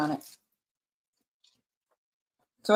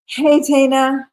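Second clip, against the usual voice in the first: bright, louder, drawn-out hello; excited greeting; 6 semitones higher, 9 dB louder, slower than usual. Real, not acted. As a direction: call out happily to a friend. Greets a friend joining live.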